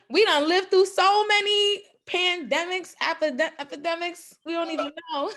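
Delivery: high, laughing run; giggling through the words. Real, not acted. Laughing about pandemics.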